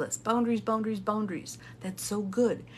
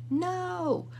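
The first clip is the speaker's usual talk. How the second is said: high, drawn-out "no"; emphatic no; 10 semitones higher than usual. Real, not acted. Speaker answering whether she is perfect, emphatic high no. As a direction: answer your own question with a high, stretched denial. Answering own rhetorical question.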